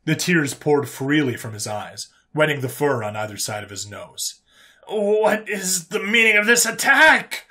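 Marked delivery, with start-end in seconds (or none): 4.9-7.4 high, loud, drawn-out indignant cry; screamed outrage. Acted. Alien prisoner accusing the human over toxic fumes, screamed. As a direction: cry out as if under attack. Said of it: "Gregor screamed".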